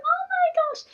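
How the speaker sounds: high squeal of delight; excited. Retold or imitated. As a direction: gush over the little girl. Retelling excited greeting of child.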